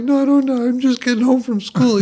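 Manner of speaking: high-pitched retold tearful protest; mock tearful. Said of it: "he starts crying immediately".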